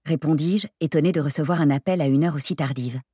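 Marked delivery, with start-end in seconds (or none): none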